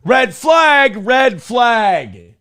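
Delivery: high, loud "Red flag!" shout; shouted alarm. Real, not acted. Shouting warning.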